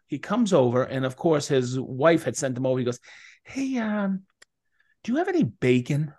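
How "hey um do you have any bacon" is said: mooching neighbor. Retold or imitated. Neighbor voiced asking for bacon.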